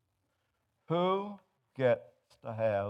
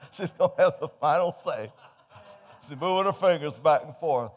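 punchline through laughter; laughing; 3 semitones higher, 6 dB louder than usual. Real, not acted. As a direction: laugh as you deliver it.

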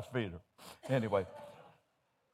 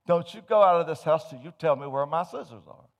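nagging wife; 4 semitones higher, 7 dB louder than usual. Acted.

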